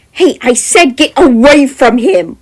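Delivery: loud, slow furious shout; angry shout. Acted. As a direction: shout him away. Jem shouts at figure.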